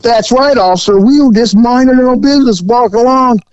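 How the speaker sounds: high, fast innocent hillbilly voice; mock hillbilly protesting his innocence. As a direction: play the wide-eyed liar.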